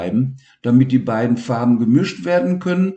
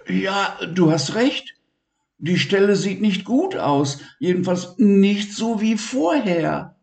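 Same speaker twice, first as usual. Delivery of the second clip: high, worried little friend; high-pitched worried Levi; Levi's voice; 10 semitones higher than usual. Acted. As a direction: ask gently like a concerned boy.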